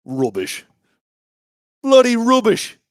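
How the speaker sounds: grumble, then exclaim in a mock English accent; hammed-up English accent outburst. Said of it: "my African or my English".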